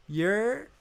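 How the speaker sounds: drawn-out New York "yerr", rising high; amateur stab at a New York "yerr"; attempted New York call. Shown as a demonstration. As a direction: try a New York street holler, pitch sliding up. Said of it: "I don't know how to do it".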